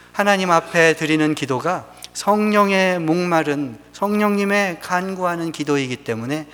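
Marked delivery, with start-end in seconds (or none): none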